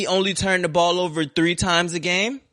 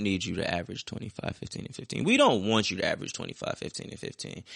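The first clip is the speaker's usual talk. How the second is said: hushed heart to heart; 9 semitones lower, 8 dB quieter, faster than usual. Acted. Heart to heart aimed at Russ.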